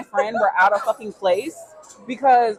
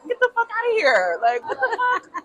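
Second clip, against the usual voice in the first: incredulous; 11 semitones higher than usual. Real, not acted. Telling her friend how absurd he was, high and incredulous. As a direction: stretch out the disbelief. Outraged aside after mock voice.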